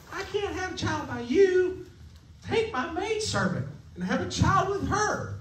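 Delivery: high, far-off voice for Sarah as she pleads; a wife offering her maidservant, pitched well up; Sarah's voice. Acted. Preacher voicing Sarah to the congregation, high and pleading.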